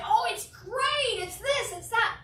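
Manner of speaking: high, gushing salesperson chatter; hammed-up upbeat answer; mock eager answer. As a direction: rattle it off like an overeager agent. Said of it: "All the time we say".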